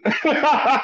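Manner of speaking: high burst of real laughter; amused laugh at the coincidence; delighted laugh. Real, not acted. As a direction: laugh out at how it worked out. Laughs at the lucky timing.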